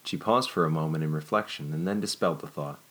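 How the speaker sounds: ordinary delivery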